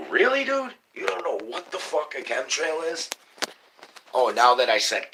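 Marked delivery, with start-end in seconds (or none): 0.1-3.1 higher, mocking disbelief; incredulous. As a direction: pitch jumps up in disbelief. Incredulous reply to ignorant person.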